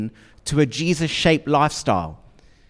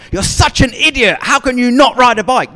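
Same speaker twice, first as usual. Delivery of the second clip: put-on raised, scornful telling-off of a child; mock scolding; 9 semitones higher, 6 dB louder than usual. Parent scolding a wobbly child, mock harsh. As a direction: scold the child high and loud. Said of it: "you don't say to the child".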